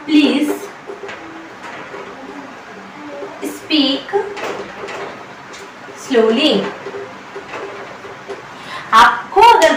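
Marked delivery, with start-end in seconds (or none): 0.1-6.5 long pauses between words; slow word by word. Shown as a demonstration. Teacher spacing the words out for students. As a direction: drill each word. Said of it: "उसकी English क्या होगी?".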